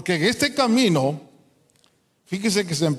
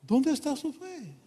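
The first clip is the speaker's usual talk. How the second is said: soft, high gentle rebuke; Jesus rebuking; 7 semitones higher, 7 dB quieter than usual. Retold or imitated. Jesus asking the frightened disciples, soft and reproachful. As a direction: ask it quietly, almost disappointed. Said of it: "les dice".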